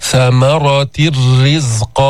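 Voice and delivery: ثمرة الرزق slow, tanween merged into a doubled راء. Shown as a demonstration. Teacher recites the Baqara example slowly. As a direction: chant slowly, the نون disappears into the راء. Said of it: "شددنا الراء وذهبت النون".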